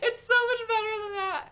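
high, loud excited exclaim. Real, not acted. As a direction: gush with delight. Excited about the tweet.

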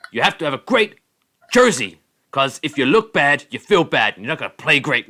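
mock pundit laying down a rule about looking good; animated pundit. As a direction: ham up a sports host preaching about jerseys. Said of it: "his usual very animated".

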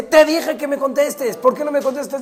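high, loud exasperated mother; scolding yell. Acted. Mamá llega y le dice al niño, gritando. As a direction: yell it at the child, high and demanding. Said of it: "y le grita".

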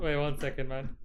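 distant, off-mic voice; moving handheld mic. Real, not acted. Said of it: "That's not gonna work".

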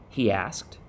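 ordinary delivery, nothing marked

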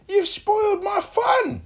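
high, drawn-out shout; indignant cry. A narrator's reading. Mister Tickle blames Little Miss Magic, high indignant shout. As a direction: shout the complaint high and slow. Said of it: "he cried".

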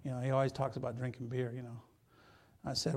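normal talking voice

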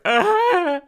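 high, loud squeal of surprise; delighted gasp. Retold or imitated. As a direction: squeal as the card sinks in. Realising flowers are from boy.

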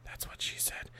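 whispered aside. Real, not acted. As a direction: breathe it under your breath.

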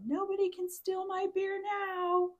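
put-on high, drawn-out triumphant drunken yell. Beach partier tossed his bag in the ocean, mock yell. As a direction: holler it slowly, acting it out. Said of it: "into the ocean and yelled".

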